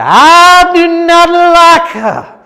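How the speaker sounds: high, loud, slow sermon cadence; booming mock sermon reading; Jesse Jackson preaching Seuss. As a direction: preach Green Eggs and Ham like scripture. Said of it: "he said".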